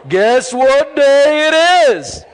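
loud, high, stretched-out call; imitating a commercial's gleeful holler; commercial impression. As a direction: belt it out slowly like the ad character. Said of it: "I love that commercial".

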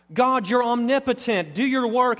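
high, loud mock-confident prayer; bold declaration. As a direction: proclaim it at full preaching pitch. Acted bold prayer to God.

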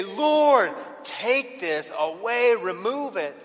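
loud, drawn-out plea to God; pleading prayer. Acted. Praying to have a problem taken away, pleading. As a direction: cry out to the Lord, then ease off.

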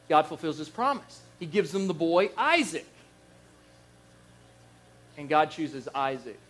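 usual speaking voice

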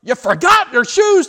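high, loud yell of disbelief; exasperated yell. Retold or imitated. Mother yells at her daughter, exasperated. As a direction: snap at the child about the shoes. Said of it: "the pastor's wife just went from".

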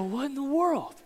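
high, bewildered "what in the world"; stunned by her brush-off; high, stunned mutter lying in bed; baffled. Retold or imitated. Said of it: "and I just go".

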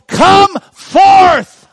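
huge high commanding shout; booming command. Acted. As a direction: call the dead man out of the tomb. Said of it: "Jesus yelled".

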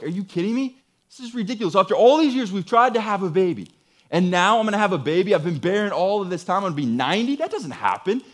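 high-pitched, incredulous protest; scoffing disbelief. Acted. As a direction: pile up objections as if it can't possibly be true. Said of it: "It's being born out of disbelief".

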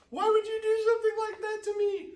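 high, loud tearful wail at dad; a tearful kid, voice cracking high; crying child's wail. Retold or imitated. Scared boy pulled from pool, wailing at his dad.

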